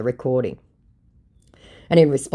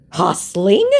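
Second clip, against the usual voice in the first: higher, louder rising query; incredulous question; 4 semitones higher, 6 dB louder than usual. Acted. Scammer echoing the host's accusation, mock incredulous. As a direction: echo the word in mock disbelief. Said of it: "Question mark, question mark".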